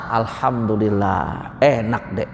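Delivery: husband praising his wife's bland cooking, low and slow; husband's polite fib. Acted. Husband fibbing about bland food.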